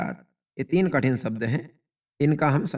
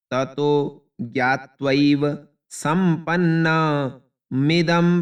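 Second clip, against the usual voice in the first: slow word reading; 3 dB louder, much slower than usual. Shown as a demonstration. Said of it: "कठिन शब्द इसमें कोई भी नहीं है".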